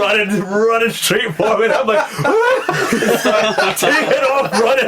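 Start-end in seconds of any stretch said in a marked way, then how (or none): 2.1-2.8 very high yelp with laughter; mock terrified squeal. Sensei fleeing a child with a spider, high mock shriek. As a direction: shriek in hammed-up fright. Retold scream as boy runs at him.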